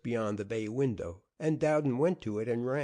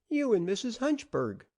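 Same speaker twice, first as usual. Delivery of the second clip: put-on high, excited little boy's call; the boy urging Beasley to start; child crying out; 12 semitones higher than usual. A narrator's reading. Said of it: "cried the child".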